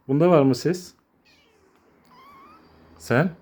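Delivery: ordinary delivery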